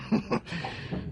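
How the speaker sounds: a soft breathy laugh at a friend; amused chuckle. Acted. Teasing Poirot about wrong ideas, light chuckle. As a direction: laugh lightly at his expense.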